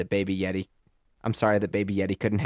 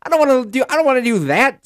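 put-on much higher rebellious little kid; toddler voice; 8 semitones higher, 6 dB louder than usual. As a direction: rage like a toddler who won't sit still. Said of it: "Baby Yeti was like".

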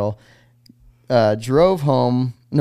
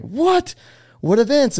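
much higher, louder astonished What?; mock shocked surprise; 4 dB louder, slower than usual. Dorm guys hearing he rode the X Games, much higher shocked surprise. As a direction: jump way up in pitch, disbelieving.

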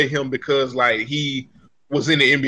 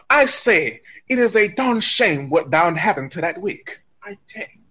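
mock English gentleman; 3 semitones higher than usual.

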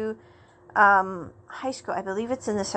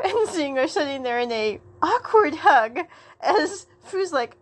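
normal talking voice

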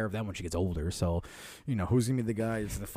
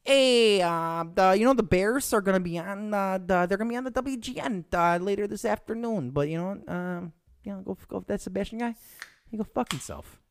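higher, louder everyday Chicago accent; real Chicago accent; 10 semitones higher, 4 dB louder than usual. Shown as a demonstration. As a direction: show how a real Arlington Heights guy talks. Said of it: "They talk like this from Arlington Heights".

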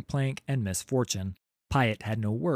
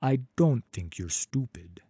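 ordinary delivery

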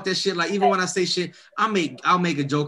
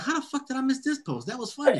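much higher, laughing disbelief; surprised disbelief; 11 semitones higher than usual. Retold or imitated. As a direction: squeak out amazed at the missed post. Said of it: "And I'm like".

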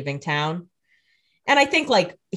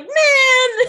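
a much higher, loud whining cry; hammed-up annoyed whine; whiny mock Rebecca; 9 dB louder than usual. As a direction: squeal it like a spoiled sister. Rebecca annoyed at being re-daggered.

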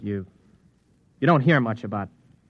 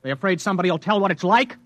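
indignant outburst; 9 semitones higher, 4 dB louder than usual. Acted. Angry outburst at the clerk.

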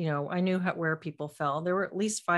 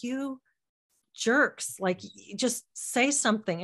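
mildly exasperated retold plea, pitched up; retold frustration; 6 semitones higher, slower than usual. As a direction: replay an inner complaint with mild exasperation. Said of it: "frustration I felt with those people".

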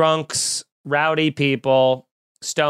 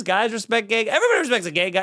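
ordinary talk, nothing marked

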